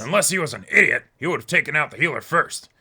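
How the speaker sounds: a hint of a blustery newspaper boss; lightly voiced barking editor; Jonah Jameson impression. Friend rereads the detective lines as a mild Jameson bluster. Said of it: "This was Jonah Jameson".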